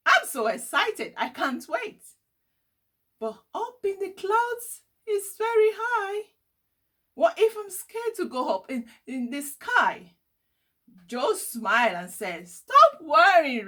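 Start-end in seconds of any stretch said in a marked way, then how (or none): 0.1-10.2 put-on high, excited child voice; a boy thrilled yet nervous; excited then worried. A narrator's reading. Riley to Joe, high excited child voice. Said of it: "gave a massive smile". 12.5-13.7 louder, slow, higher comfort; reassuring. A narrator's reading. Joe comforts worried Riley, slow loud reassurance.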